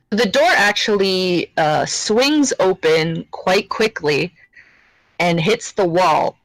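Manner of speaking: normal talking voice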